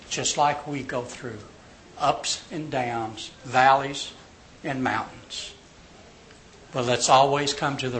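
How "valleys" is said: usual speaking voice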